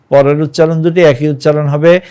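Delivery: usual speaking voice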